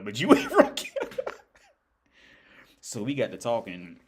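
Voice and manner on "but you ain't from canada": high, loud, laughing "ain't from Canada"; mock insistence. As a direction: tease her, hammed up and loud. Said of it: "mock insisting she's not Canadian".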